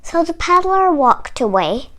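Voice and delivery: usual speaking voice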